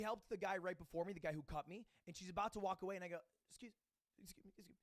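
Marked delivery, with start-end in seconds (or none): 3.5-4.8 tiny, barely audible stammer; hushed, faltering mock plea; timid attempt. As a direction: meekly try to get attention. Acts timidly trying to get attention.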